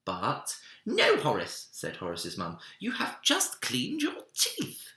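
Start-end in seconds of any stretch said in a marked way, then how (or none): 0.9-1.7 higher, louder mum "No, Horace"; a mother's firm warning; stern mum. Acted. Mum forbids third time. 2.8-4.9 very high, slow mother's reminder; a sing-song mum drawing it out. Acted. Mum at bedtime.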